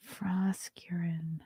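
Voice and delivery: whispered to herself. Real, not acted. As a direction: breathe it out, unvoiced. Searching quietly to herself.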